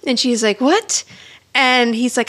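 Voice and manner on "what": high, rising mock disbelief; surprised. Host voicing a woman told they'd marry, surprised. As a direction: jump up in pitch as if startled. Retold startled reaction to marriage claim.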